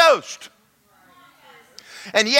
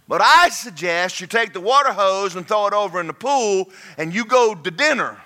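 usual speaking voice

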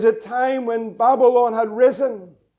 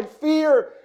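usual speaking voice

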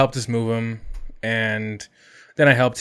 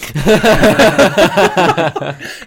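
loud, high burst of real laughter; 7 semitones higher, 8 dB louder than usual. Real, not acted. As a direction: crack up at your own blunder. Laughing at own blunder.

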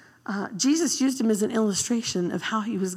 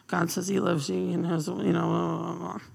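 reluctant drone; 5 semitones lower than usual. Retold or imitated. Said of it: "I picture him going".

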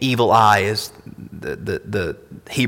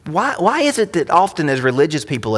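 ordinary talk

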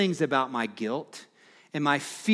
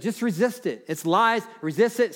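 raised, ringing pulpit voice, pitched higher; fervent preaching; 5 semitones higher, 3 dB louder, faster than usual. Acted. Pastor urging his church to speak the word, fervent preaching. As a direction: preach it out to the whole room. Preacher exhorting against lies.

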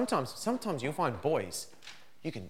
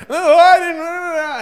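put-on very high, loud, slow drawn-out child whine; whiny child protest; 16 dB louder, much slower than usual. Child whines to dad about the consequence, high drawn-out whine. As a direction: stretch it out like a caught kid.

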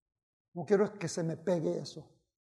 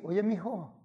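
usual speaking voice

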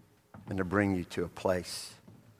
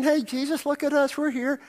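a hint of a proud Peter; Peter showing off; 5 dB louder than usual. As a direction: call out proudly to Jesus. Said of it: "he's saying".

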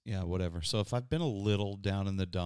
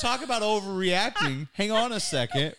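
laughing; 8 semitones higher, 5 dB louder than usual. Real, not acted. Laughing at partner's story.

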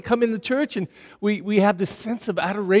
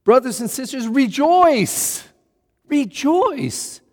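joyful exhortation; 7 semitones higher, slower than usual. Retold or imitated. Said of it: "And he says this".

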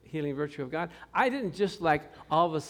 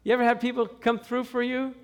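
normal talking voice

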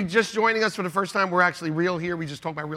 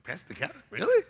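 incredulous question; 5 semitones higher than usual. Retold or imitated. Dad doubting son's choice of Pastor Kevin, incredulous. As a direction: repeat the name in disbelief, jumping up on "Really". Surprised echo of son's answer.

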